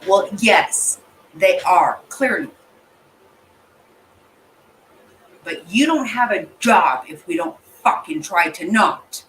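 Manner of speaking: loud, heated retort; angry outburst. Real, not acted. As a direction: shout back at the screen. Angry rant at people in clip.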